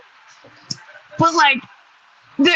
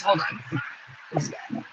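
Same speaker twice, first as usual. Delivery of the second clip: holding back hiccups, breath caught; fighting off a hiccup mid-sentence; suppressing hiccups; 5 semitones lower, 12 dB quieter than usual. Real, not acted. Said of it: "Okay, I'm getting the hiccups".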